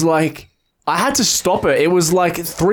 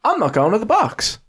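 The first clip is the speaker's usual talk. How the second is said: higher-pitched dawning realisation; retold realisation; 7 semitones higher than usual. Friend hearing of the hospital, retold dismayed realisation. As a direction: re-enact it sinking in. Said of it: "I was like".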